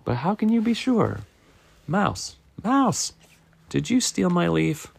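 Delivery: high-pitched accusing squirrel; suspicious Squirrel. Acted. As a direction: question the suspect in a high, urgent voice.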